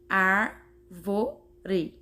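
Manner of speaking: "árvore" split as ár, vo, re; syllable by syllable. Shown as a demonstration. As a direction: count out "árvore" with gaps between syllables. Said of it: "Contou as sílabas daquela forma".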